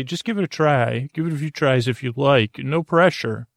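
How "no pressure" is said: usual speaking voice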